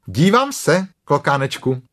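firm like a scolding mum; strict Kanga. Acted. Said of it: "řekla klokanice přísně". (přísně, vyšším hlasem)

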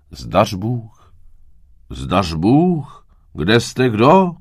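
loud, rising hail, drawn out at the end; calling out. A narrator's reading.

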